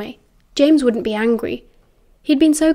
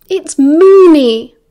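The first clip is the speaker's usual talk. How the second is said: "Moony" drawn out long, loud and high; spelling it out; 5 semitones higher, 10 dB louder, much slower than usual. A narrator's reading. James to Sirius like a stupid first year, "Moony" laboured and loud. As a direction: stretch "Moony" as if to someone very slow. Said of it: "as if Sirius was a particularly stupid first year".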